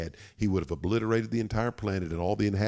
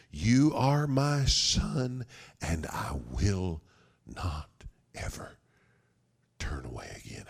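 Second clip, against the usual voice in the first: solemn fatherly vow; slower than usual. Acted. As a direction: start raised, then sink word by word. Father's vow to his son.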